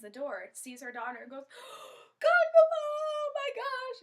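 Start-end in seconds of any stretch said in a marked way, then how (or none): 1.5-2.1 big sharp intake of breath; horrified gasp. Acted. Mother sees her daughter, horrified gasp. 2.2-4.0 very high, very loud, drawn-out wail; freaking-out scream. Acted. Mother sees her daughter's eye, freaking-out scream. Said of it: "And she freaks out".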